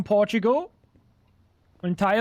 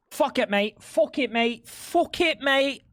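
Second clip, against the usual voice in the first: pitched-up cockney "fuck it, mate"; jokey fake Brit voice; mock British accent; 5 semitones higher, slower than usual. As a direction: put on a silly British accent, rising each time. Mock British accent on quoted line.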